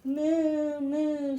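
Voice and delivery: long, high, loud moo; cow mooing. A narrator's reading. Story reader voices the cow for children, mooing. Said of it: "said the cow".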